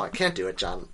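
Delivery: usual speaking voice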